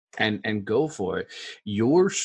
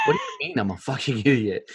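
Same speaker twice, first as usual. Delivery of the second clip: retold indignant comeback, pitched up with a laugh; mock indignation; 4 semitones higher than usual. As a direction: play back your baffled protest, half laughing. Retold startled reply to coach's insult.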